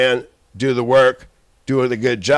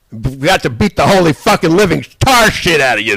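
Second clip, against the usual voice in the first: angry outburst; 4 semitones higher, 4 dB louder than usual. Real, not acted. Threatening rant.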